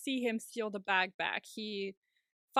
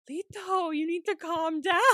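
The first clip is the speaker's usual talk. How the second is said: exaggerated pleading; 6 semitones higher, 3 dB louder than usual. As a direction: plead with the hysterical boyfriend, pitched up. Acting boyfriend calming him, hammed up.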